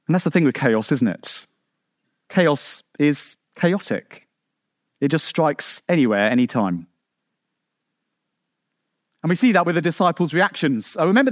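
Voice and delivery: ordinary talk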